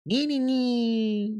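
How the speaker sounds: very high, drawn-out alien squeal; little alien voice. Shown as a demonstration. Musician demonstrating his song voice, high-pitched little alien. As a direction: squeak long notes like a tiny alien. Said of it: "I do a high-pitched voice, like a little alien voice".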